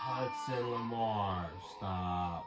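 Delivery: drawn-out full name, then a firm stop; slow warning call by full name. Real, not acted. Dad to son climbing, slow full-name warning.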